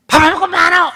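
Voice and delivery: put-on the wrong way, a mother yelling at her kid; angry nagging shout. Shown as a demonstration. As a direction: snap at the child for not eating. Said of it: "이러면 안 된다는 거예요".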